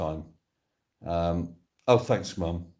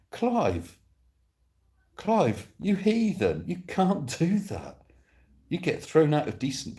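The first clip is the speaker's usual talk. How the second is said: mock outrage; 8 semitones higher than usual. Mock outrage at Clive's cream.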